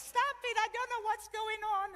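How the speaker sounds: very high, slow, exasperated squawk; loud protest. Retold or imitated. Widow protesting as friends laugh at her, exasperated. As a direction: imitate a flustered widow crying out.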